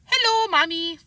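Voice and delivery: lightly voiced little boy greeting; a hint of small child innocence. Boy greets his mother, light child voice.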